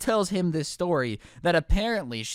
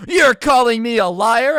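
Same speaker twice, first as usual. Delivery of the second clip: loud, high, drawn-out outrage; mock screamed accusation; imitated screaming; 8 semitones higher, 12 dB louder than usual. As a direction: shriek it like an outraged customer. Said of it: "she's screaming at my manager".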